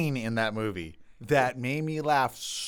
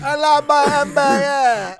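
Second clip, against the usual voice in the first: put-on high, loud, mangled French accent; hammed-up French pupil failing at English; thick French accent; 13 semitones higher, 12 dB louder, slower than usual. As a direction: shout it slowly in an absurd French accent. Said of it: "get rid of his French accent".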